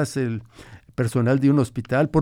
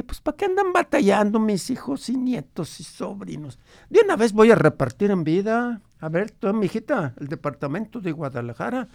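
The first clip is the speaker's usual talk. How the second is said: lightly voiced grandfather, a bit higher; old grandfather; 6 semitones higher than usual. Grandfather recalls his ranch for the radio host, lightly voiced old man. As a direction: tell it as the old abuelo remembering his ranch.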